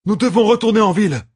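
high fast urgent yell; pressing the woman to flee back to town; shouted. A narrator's reading. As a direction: push the words out loud and quick. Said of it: "cria Perrine".

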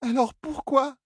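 high, tearful plea; crying Elaine. Acted. Elaine weeping to Tom, high and tearful. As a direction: ask why through tears. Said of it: "Elaine s'avisa qu'elle pleurait".

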